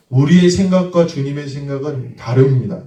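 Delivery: ordinary talk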